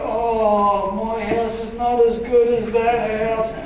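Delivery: drawn-out, hammed-up discontent; mock envy. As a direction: act out coveting a neighbour's house. Voices envious viewer of houses.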